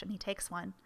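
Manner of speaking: ordinary talk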